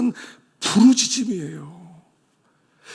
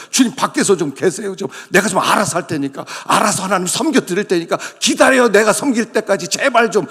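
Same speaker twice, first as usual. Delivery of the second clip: louder, impatient brush-off; mock dismissive believer; 4 semitones higher, 7 dB louder than usual. 귀찮은 성도가 주님을 문 밖에 세워 두며, 크고 짜증 섞인 말투. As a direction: wave the Lord away.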